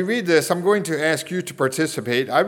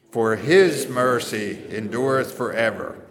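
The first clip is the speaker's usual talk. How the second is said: HIS raised and punched, the rest steady; stress on HIS; 2 semitones lower than usual. Shown as a demonstration. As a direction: lead the refrain, leaning on his. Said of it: "emphasize the word his".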